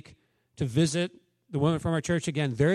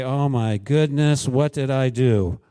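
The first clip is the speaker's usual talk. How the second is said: slow, louder, mildly hammed-up dismay; mock exasperated God; 3 semitones lower, 4 dB louder, slower than usual. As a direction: sigh over hopeless disciples, playfully. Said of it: "God doesn't look at ... and just say".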